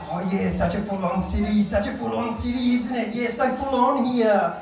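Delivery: lightly exaggerated Australian accent. Australians greeting a fellow expat, Australian accent. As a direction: chat in a broad-ish Australian accent. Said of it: "all the Australians would come up to me".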